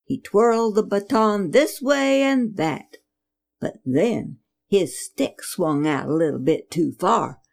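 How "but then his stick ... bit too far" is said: usual speaking voice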